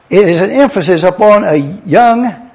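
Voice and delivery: usual speaking voice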